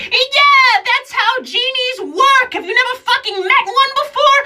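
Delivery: very high, loud, exasperated genie; sassy genie. Acted. Genie scoffing at the comic who never met one, very high. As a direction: snap at them as an annoyed genie. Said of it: "And she said".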